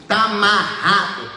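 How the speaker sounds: slow, loud rebuke; rebuking worker. Retold or imitated. Church worker binding the demon, slow loud rebuke. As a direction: drag out the binding words like a church worker casting out. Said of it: "ela falou assim".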